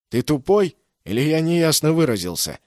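high and loud irritated snap; angry. A narrator's reading. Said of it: "разозлился охранник".

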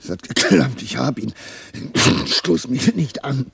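triumphant, then careful not to be jostled; excited. Acted. Experiment succeeds, excited reply.

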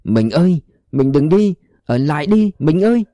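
a man crying out for his fading wife. A narrator's reading. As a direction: call after a vanishing wife. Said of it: "gào lên".

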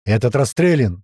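loud call across distance; shouted report. Acted. Krot reports a shot-up boat, shouting. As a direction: shout it while still running up. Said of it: "закричал Крот".

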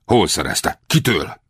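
urgent, mildly heated questioning; flaring up. A narrator's reading. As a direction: demand it with a sudden flare of temper. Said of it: "újra felcsattant a hangja".

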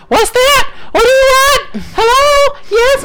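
very high, eager little put-on voices; other smart speakers. Imitating the other two devices butting in from there, very high voice. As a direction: chirp each reply way up in pitch. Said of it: "and the other two in there".

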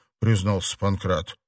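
ordinary delivery

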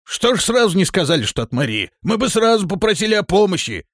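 high, loud, eager outburst; joyful. A narrator's reading. Villager learning they come from Мария, joyful. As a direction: burst out with delighted relief. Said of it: "быстро заговорил мужчина с радостью".

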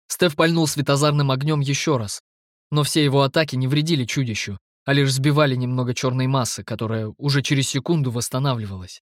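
ordinary delivery, nothing marked

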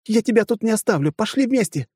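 high desperate shout; pleading shout. Acted. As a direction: refuse to leave him, shouting. Partner refuses to leave.

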